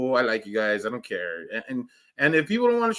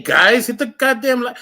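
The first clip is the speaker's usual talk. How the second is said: louder, higher mock demand; 6 semitones higher, 5 dB louder than usual. Asking viewers for likes, loud mock demand. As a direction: bark it at the viewers. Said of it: "I just heard myself going".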